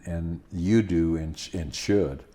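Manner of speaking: usual speaking voice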